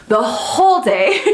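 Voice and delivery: laughing through the words; slow, giggly real laugh; real laugh breaking in. Real, not acted. Laughing at own crying.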